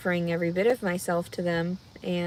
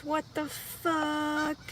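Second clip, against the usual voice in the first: stunned; 7 semitones higher, 5 dB quieter, much slower than usual. Real, not acted. Shock at due date.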